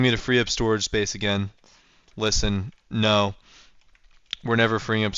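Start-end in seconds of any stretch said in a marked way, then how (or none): none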